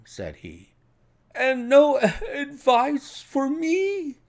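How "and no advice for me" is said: very high, slow, desperate question; plaintive appeal. A narrator's reading. Patient begging the departing detective for advice, plaintive appeal. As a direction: wail it slowly after someone leaving.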